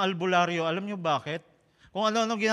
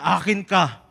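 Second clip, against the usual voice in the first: loud, menacing demon voice; demon threat; 8 dB louder than usual. Acted. Demon threatening the five-year-old in his dream, loud and menacing. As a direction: boom it out like the demon in the nightmare. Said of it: "Sasabihin niya".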